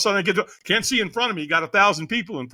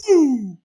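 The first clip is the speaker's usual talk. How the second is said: loud, high imitated "boom"; whoosh of takeoff; 7 semitones higher, 5 dB louder than usual. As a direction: shoot off with a sound effect. Imitated noise of taking off.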